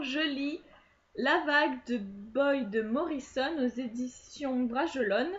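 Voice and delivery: ordinary talk, nothing marked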